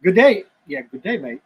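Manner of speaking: a hint of Aussie "Good day, mate"; mild Crocodile Dundee style greeting; Australian accent. Host trying out an Aussie greeting, lightly accented. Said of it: "Crocodile Dundee".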